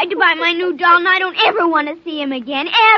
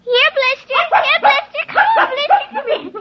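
calling the dog; 10 semitones higher than usual. Acted. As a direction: call out for the puppy. Girl calling her dog.

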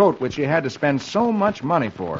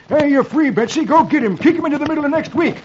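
high, loud, rushed hermit cry; sudden exclamation; 13 semitones higher, 4 dB louder than usual. A narrator's reading. Hermit sets Betsy the mule on Roy, excited high yell. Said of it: "Suddenly the hermit exclaims".

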